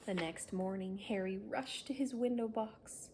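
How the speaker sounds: plain reading voice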